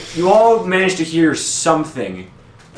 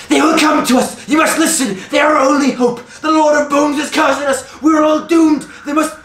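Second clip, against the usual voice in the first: high, urgent cry of a doomsayer; frantic yelling; 11 semitones higher, 4 dB louder than usual. Acted. Game master as ragged woman warning the town, frantic high yelling. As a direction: yell out a desperate warning in a much higher voice. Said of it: "she's yelling out, like".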